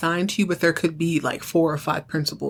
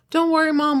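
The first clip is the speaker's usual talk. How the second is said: higher and louder, brightly reassuring; reassuring big brother; 9 semitones higher, 4 dB louder than usual. Retold or imitated. Son telling his mom not to worry. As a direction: ease Mom's worry lightly.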